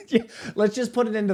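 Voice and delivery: laughing while talking. Real, not acted. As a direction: laugh through the words. Laughs at chat suggestion.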